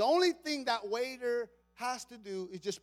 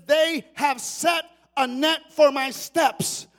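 loud, driving sermon cadence; full-force preaching; 3 semitones higher, 12 dB louder than usual. Acted. Preacher pressing the point to the congregation at full volume. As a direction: keep hammering it home.

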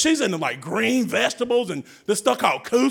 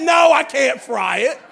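exasperated mother; 5 semitones higher, 6 dB louder, slower than usual. Retold or imitated. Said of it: "My wife go".

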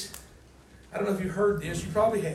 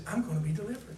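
quiet; 7 dB quieter, much faster than usual. Acted. Voicing Paul, quiet take.

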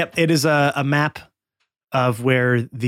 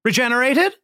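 much higher, louder huckster voice; slick salesman; 11 semitones higher, 5 dB louder than usual. Acted. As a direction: call out like a carnival pitchman. Scam Likely again.

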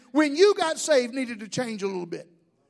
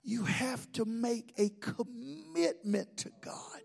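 very slow and soft, word by word; slow, solemn reveal; 3 semitones lower, 10 dB quieter, slower than usual. Real, not acted. Preacher revealing the ugly word to the congregation, slow and hushed. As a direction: let each word land quietly and deliberately.

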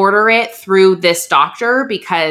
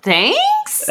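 a much higher, questioning thanks; unsure thanks. Retold or imitated. Daughter answers mom's backhanded compliment with an unsure, high thanks. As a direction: thank her with confused doubt. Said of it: "I was like".

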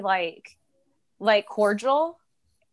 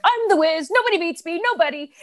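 a higher, cocky Wiz boast; Seinfeld Wiz bit; 8 semitones higher than usual. Retold or imitated. Guest playing the Wiz from Seinfeld, boasting in a higher voice. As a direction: brag like the Wiz, nobody beats me. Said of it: "doing this thing on Seinfeld where I was like".

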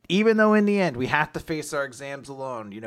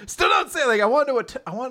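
high-pitched mock outburst; hammed-up fed-up daughter; exasperated protest; 11 semitones higher, 4 dB louder, faster than usual. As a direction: blurt it high in mock annoyance. Retold daughter protests at dad.

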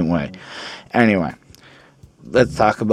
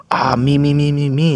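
higher, chanted mimicry of egotists; mock self-centred; 6 semitones higher than usual. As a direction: parody everyone clamouring for attention. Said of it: "Everyone's just".

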